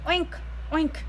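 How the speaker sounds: playful piggy grunts; pig oinks. Acted. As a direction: oink like pigs. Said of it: "said Pig and Pig".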